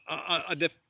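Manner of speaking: ordinary delivery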